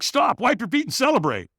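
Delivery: high, urgent mock call; calling out orders. Helpers stop firewalker at the end, high called-out orders. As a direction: imitate helpers halting a runner.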